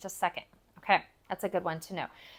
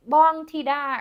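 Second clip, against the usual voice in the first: calling out; 6 semitones higher, 8 dB louder, slower than usual. Shown as a demonstration. Calling into a house from outside, loud call. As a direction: call the name out loud and high. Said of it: "You would just yell it from outside".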